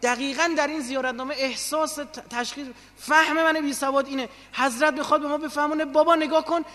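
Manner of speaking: high, loud, insistent sermon delivery; impassioned preaching. Acted. Preacher urging listeners to picture the absent Imam, impassioned.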